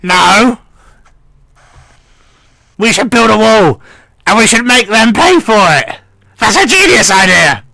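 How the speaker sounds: put-on high, reedy old gnome voice, henpecked; old gnome mishearing superior as wife, high and fretful; old gnome lord. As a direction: whine it up high like a fussy old lord avoiding his wife. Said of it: "deep thought as he goes".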